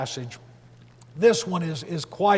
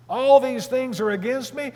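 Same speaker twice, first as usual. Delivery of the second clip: incredulous echo; 6 semitones higher, 4 dB louder than usual. Acted. Challenging Jacob's lament before answering No.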